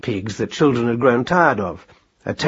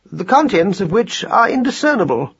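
high, precise pronouncement; formal observation, pitched well up; pedantic scholar mouse; 8 semitones higher than usual. A narrator's reading. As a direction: announce it like a learned little mouse. Voices Marcus Aurelius.

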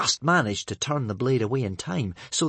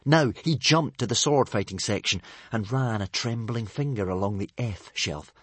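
ordinary delivery

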